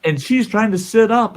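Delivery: usual speaking voice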